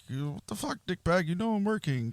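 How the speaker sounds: high, exasperated retold phone rant; mock annoyed greeting. Retelling an early work call from a friend, mock annoyed greeting. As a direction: act out griping at a friend who calls.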